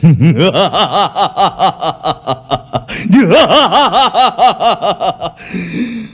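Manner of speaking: booming, rolling stage-villain laugh. Acted. Ravan on stage, laughing off Ram's arrow with a booming villain laugh. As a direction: laugh off the arrow like a proud demon king. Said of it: "Ravan दो तीर में हंसना है".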